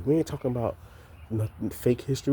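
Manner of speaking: ordinary delivery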